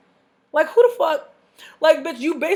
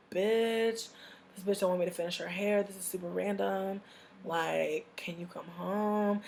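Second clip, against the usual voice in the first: much softer, ending in a whisper; hushed aside; 4 semitones lower, 11 dB quieter than usual. Retold or imitated. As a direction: keep it low so the client can't hear. Secretly texting her friend.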